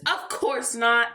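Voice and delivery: high, emphatic answer; reply as a mildly scandalised teacher; lightly acted firm correction. Teacher answers the kids firmly.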